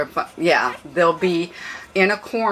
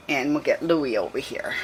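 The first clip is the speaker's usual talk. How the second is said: ordinary talk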